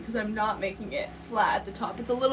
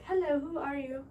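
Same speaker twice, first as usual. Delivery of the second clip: lightly voiced phone robot; a hint of an answering robot; 4 semitones higher, 5 dB quieter than usual. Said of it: "I have a robot".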